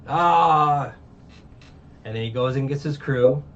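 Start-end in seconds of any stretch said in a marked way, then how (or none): 0.1-0.9 one long, loud "ah"; mock heroic holler, drawn out; imitated battle yell. Luffy answers crying Nami's plea, mock yell.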